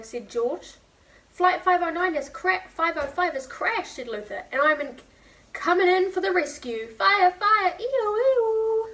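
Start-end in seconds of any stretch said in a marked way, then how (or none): 1.3-8.9 high, loud siren play voice; excited rescue pilot. Acted. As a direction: wail the ee-oh like a fire engine. Luther playing rescue pilot.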